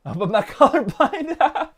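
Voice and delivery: high, laughing through the words. Real, not acted. Joking to viewers about being colorblind, laughing. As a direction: break into laughter mid-line.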